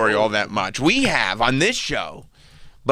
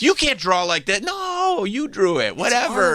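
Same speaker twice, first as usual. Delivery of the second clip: high, squeaky put-on bickering; mock quarrel; 9 semitones higher than usual. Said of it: "argument between characters".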